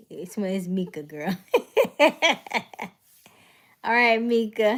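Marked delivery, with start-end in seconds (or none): 1.4-2.9 bright laugh. Real, not acted. Laughing at her own name joke.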